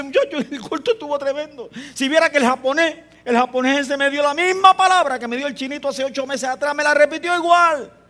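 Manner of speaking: agudo, más alto y entusiasmado; contándole a los hermanos con entusiasmo; emocionado contando. Retold or imitated. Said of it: "Y dices". (relato entusiasmado y agudo)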